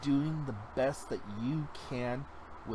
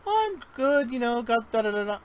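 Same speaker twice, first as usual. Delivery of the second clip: high, bright brush-off, rattled off fast; mimicking a breezy answer; 11 semitones higher, 8 dB louder than usual. Mimicking a stranger's breezy brush-off of a greeting. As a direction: toss off a quick cheery reply. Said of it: "I'm like".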